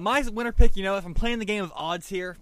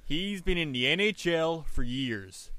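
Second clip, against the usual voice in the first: Canadian hockey guy. Pretend. Pitching Tom Laidlaw to friends in a mock Canadian accent. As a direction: talk up your buddy Tom in a Canadian accent. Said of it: "I gotta get in my Canadian mode here".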